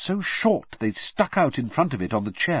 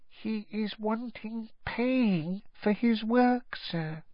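slow, soft, high elf voice; hushed elf confiding; 11 semitones higher, 5 dB quieter, slower than usual. A narrator's reading. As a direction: confide a scandal in a small hushed squeak. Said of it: "lowered her voice by a half octave and whispered".

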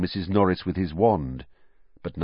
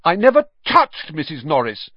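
loud, higher-pitched protest; 9 semitones higher, 6 dB louder than usual. A narrator's reading. Accused boy defending himself before everyone, loud. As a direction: deny it loudly and indignantly. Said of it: "Harry said loudly".

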